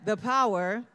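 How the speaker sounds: affirmation called out loud with a laugh. Shown as a demonstration. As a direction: say it out loud to your neighbour. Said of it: "Repeat after me and say".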